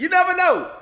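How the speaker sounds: comic high squeal of emphasis; high-pitched repeat. Real, not acted. YouTuber doubting if the track is AI, high-pitched emphasis. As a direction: jump way up high on NEVER, then drop.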